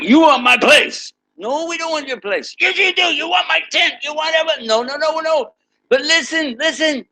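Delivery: high, excited back-and-forth between the stubborn man and the believers; acted argument. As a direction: play both sides of a squabble, pitched well up. Acted quarrel with the disbeliever.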